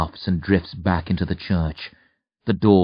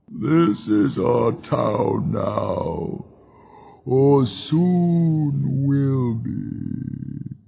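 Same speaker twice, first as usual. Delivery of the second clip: mournful demon; 4 semitones higher, much slower than usual. A narrator's reading. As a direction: lament mortal ignorance slowly. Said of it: "the demon says".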